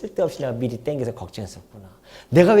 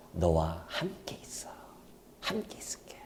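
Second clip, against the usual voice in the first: fading to a slow, soft whisper; whispered promise; 10 semitones lower, 15 dB quieter than usual. Retold or imitated. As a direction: hush down to a tender whisper. God's promise voiced softly. (하나님이 이삭에게 속삭이는 약속)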